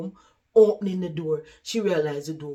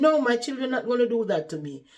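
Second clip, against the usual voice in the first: quick, loud, higher-pitched protest; adamant denial; 7 semitones higher, 5 dB louder, faster than usual. Retold or imitated. Mother defending her children to a doubter, adamant. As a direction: insist firmly, rushing it out. Resident rejects warning, retold.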